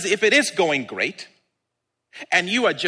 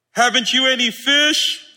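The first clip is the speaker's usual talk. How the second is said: raised, higher shouted question; calling out; 5 semitones higher, 4 dB louder than usual. Acted. Jesus asking disciples about their catch, calling out. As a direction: call the question across the water. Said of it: "He called out to them".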